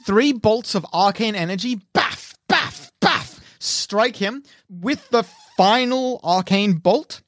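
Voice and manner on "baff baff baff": punchy imitated magic impacts; impact noises. Game master sounds out three arcane bolts striking, imitated thuds. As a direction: puff out each hit like a sound effect.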